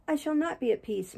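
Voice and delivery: a weak, pained moan. A narrator's reading. Catherine answering Heathcliff from her sickbed, moaning. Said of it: "moaned Catherine".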